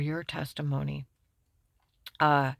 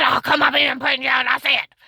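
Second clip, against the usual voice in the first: mock threat; 6 semitones higher, 13 dB louder, faster than usual. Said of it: "He said".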